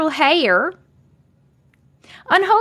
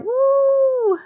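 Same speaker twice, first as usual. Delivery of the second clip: excited woo; 12 semitones higher than usual. Real, not acted. Cheering the first gifter.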